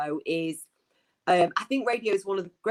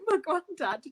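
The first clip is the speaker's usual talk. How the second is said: usual speaking voice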